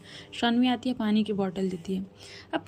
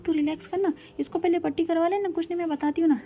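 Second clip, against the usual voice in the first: urgent; 7 semitones higher than usual. Acted. Sister pleading about bleeding hand.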